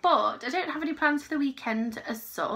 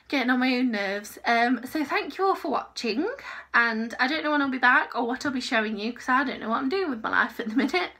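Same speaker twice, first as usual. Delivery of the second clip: ordinary talk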